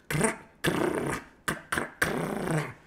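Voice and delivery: high, breathy crack sounds like rifle shots; imitated gunfire. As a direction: spit out each crack like a gunshot. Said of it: "and they're going".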